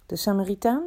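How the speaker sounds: "Samaritaan" rising at the end as a question. A narrator's reading. Teacher checks the scholar's answer, "Samaritaan" said questioningly. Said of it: "vroeg hij".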